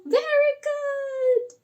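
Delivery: very high, drawn-out singsong praise; play-acted high-pitched teacher approval; delighted praise. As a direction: cheer slowly in a high voice for a small child. Teacher cheers puppet's right answer.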